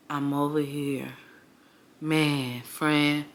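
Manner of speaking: imitating a friend barely audible and down; low sad murmur. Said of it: "She talking so low".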